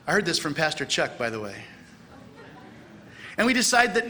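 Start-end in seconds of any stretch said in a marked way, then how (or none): none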